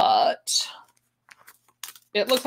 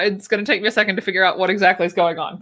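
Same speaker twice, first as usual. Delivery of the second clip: ordinary talk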